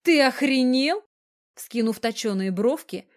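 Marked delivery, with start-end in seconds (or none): 0.0-1.0 high, loud outraged shriek; indignant squeal. A narrator's reading. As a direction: squeal it in shock, pitch shooting up. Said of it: "взвизгнула она и". (возмущённый визг)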